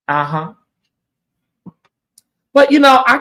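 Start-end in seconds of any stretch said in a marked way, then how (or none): 2.5-3.2 sudden loud outburst. Real, not acted.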